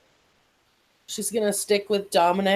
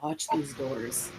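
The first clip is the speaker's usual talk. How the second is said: very quiet, slow and low; barely audible, secretive warning; hushed whisper; 6 semitones lower, 10 dB quieter, slower than usual. Acted. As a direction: whisper it as quietly as you can. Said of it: "whispers as quietly as humanly possible".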